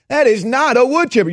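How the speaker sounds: a lightly voiced sneering denier; mocking stubborn liberal. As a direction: mimic the stubborn denier, nasally high.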